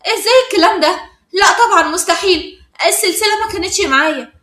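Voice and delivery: high, loud shocked denial. A narrator's reading. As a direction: protest the accusation in rising disbelief. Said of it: "إيناس اتصدمت وقالت".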